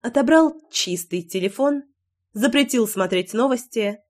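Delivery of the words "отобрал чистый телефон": usual speaking voice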